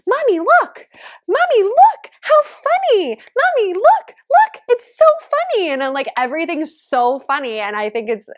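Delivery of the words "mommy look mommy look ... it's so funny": put-on high, loud little-kid squeal of delight; excited toddler voice. Mom imitates her toddler son pointing at things, high and loud. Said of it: "he points at everything and he goes".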